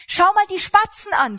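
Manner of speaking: loud, high, urgent call to look; Jesus urging worried listeners to look at sparrows, calling out. Acted. Call it out like pointing at the sky. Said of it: "sagt er".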